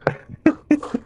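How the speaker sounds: high squawking ostrich call; ostrich noise. Shown as a demonstration. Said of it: "le bruit de l'autruche".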